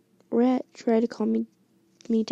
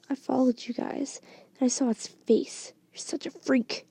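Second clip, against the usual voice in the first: ordinary delivery, nothing marked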